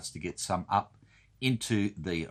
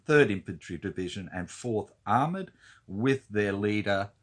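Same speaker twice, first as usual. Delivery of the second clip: usual speaking voice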